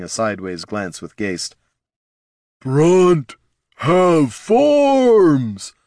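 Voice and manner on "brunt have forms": loud booming ogre voice; thundering ogre. Acted. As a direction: boom it out like an angry ogre. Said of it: "thundered the ogre".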